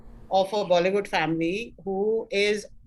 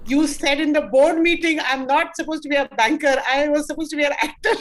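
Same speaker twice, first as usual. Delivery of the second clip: louder, higher quoted message; retold incredulity; 5 semitones higher, 5 dB louder than usual. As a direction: act out an astonished message. Retells legal head's indignant message.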